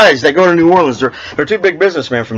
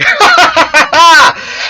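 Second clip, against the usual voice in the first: cracking up at the punchline; hearty laugh, high and loud; 13 semitones higher, 4 dB louder than usual. Real, not acted.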